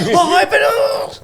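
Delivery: very high, laughing yelp of fright; hammed-up shriek of surprise; mock startled cry. Joking as walkers startled by a runner behind them, mock panic.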